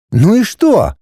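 high-pitched puzzled retort; uncomprehending. A narrator's reading. Wife failing to see husband's problem, uncomprehending. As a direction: ask it as if nothing matters. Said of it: "непонимающе уставилась на него жена".

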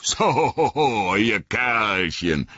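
high, hearty, slow greeting; chuckling and pleased; jolly old Tom. Acted. Tom Bombadil addressing the rescued hobbits, hearty jolly voice.

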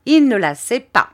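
loud and high, hard verdict; terrible voice. A narrator's reading. Stern sister-in-law condemning the boy, loud and harsh. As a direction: slam the judgment down, loud and high. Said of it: "d'une voix terrible".

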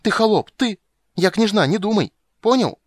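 put-on very high, loud haughty child's tantrum; shrieking. A narrator's reading. Little princess puts the witcher in his place, shrieking. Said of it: "взвизгнула она".

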